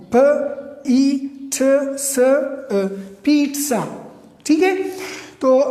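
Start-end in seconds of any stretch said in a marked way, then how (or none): 0.1-2.7 پ، ای، ٹھ، س، ا as separate sounds "puh, ee, tuh, suh, uh". Shown as a demonstration. Teacher reads the transcription to learners, پ، ای، ٹھ، س، ا sounded out one by one. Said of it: "لیکن یہ sounds ہیں". 3.2-3.8 پیٹزا blended as "peet-sa"; correct pronunciation. Shown as a demonstration. Teacher blends the sounds for learners, پیٹزا as "peet-sa", careful model pronunciation. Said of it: "لیکن یہ sounds ہیں".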